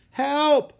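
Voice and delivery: high, very loud cry for rescue; yelling for help. A narrator's reading. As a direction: yell as if in danger. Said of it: "someone yelled".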